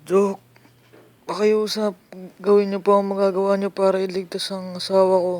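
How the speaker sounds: high, soft desperate begging; pleading. Acted. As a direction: beg the doctor for her life. Husband begs doctor to save wife.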